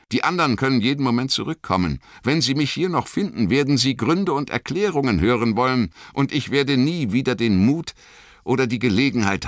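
fast urgent pleading; hurried. A narrator's reading. Frodo urges Sam to let him go, hurried. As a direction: rush it, pressing.

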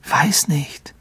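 soft, breathy reply; whisper. A narrator's reading. Harry answering Ron in the dark, whispered. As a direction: whisper it uncertainly.

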